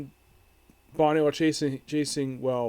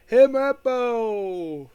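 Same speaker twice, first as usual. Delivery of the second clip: long, stretched-out yell, pitched higher; mock drawn-out call; 8 semitones higher, 7 dB louder, much slower than usual. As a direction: draw the nickname out like a big booming hail. Garp calls out the nickname.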